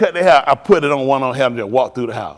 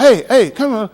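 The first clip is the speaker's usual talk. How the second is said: calling out; 8 semitones higher, 6 dB louder than usual. Retold or imitated. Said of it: "I'm just hollering".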